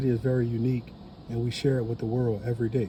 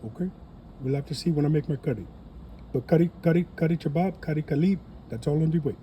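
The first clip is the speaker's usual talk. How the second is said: put-on playful Indian accent teasing his curry dish; 5 semitones higher, faster than usual. Vlogger jokes about making curry, hammed-up Indian accent. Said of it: "talk in my Indian accent".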